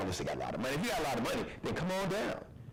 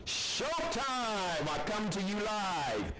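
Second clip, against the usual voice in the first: show host intro; 10 semitones higher than usual. Acted. Host greeting viewers at showtime, high stretched announcer style. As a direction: belt out the show opening, stretching the last words.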